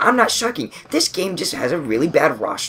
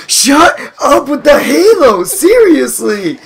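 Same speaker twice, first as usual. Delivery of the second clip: slow, higher, louder exasperated yell; angry shout; 10 semitones higher, 7 dB louder, slower than usual. Retold or imitated. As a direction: yell at the annoying Halo fan. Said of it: "He's like".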